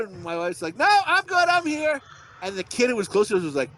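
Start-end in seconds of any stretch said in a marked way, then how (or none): 0.8-2.0 high-pitched called-out reply; imitating joking wife. Wife joking back at parking-lot kids, high imitation. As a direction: call it back brightly, pitched way up.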